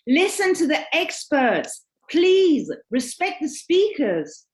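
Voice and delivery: pleading protest. Retold or imitated. Reads out participants' protesting plea.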